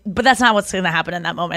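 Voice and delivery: usual speaking voice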